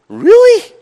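high, loud incredulous laugh of a question; surprised disbelief. Retold or imitated. Preacher retelling his amazed reply, high and laughing. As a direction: blurt it out, half laughing, not believing it. Startled reaction to being attacked.

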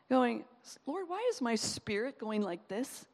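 normal talking voice

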